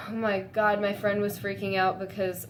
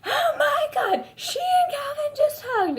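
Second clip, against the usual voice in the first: much higher, louder tearful squeal; excited scream; 3 dB louder than usual. Retold or imitated. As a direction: reenact screaming at the screen. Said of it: "I screamed".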